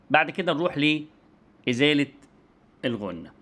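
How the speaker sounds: usual speaking voice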